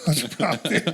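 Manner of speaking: ordinary delivery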